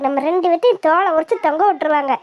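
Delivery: ordinary talk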